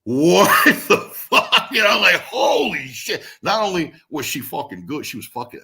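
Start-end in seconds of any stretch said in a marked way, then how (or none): 0.0-3.2 loud, laughing disbelief, slowed; jaw-on-the-floor amazement; stunned shock. Retold or imitated. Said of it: "I was like".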